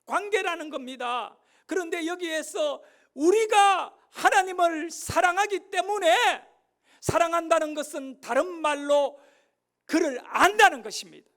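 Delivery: loud, high, impassioned sermon delivery; full-force preaching. Acted. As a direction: preach it out loud to the whole church. (힘차게 외치는 설교조)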